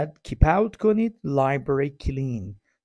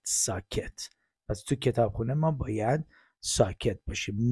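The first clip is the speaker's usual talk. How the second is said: ordinary talk, nothing marked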